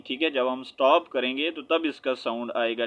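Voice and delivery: ordinary talk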